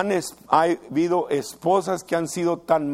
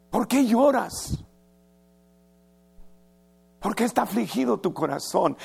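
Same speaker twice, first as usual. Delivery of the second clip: tender husband; 6 semitones higher than usual. Retold or imitated. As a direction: ask her gently, full of concern. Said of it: "sensible y tierno".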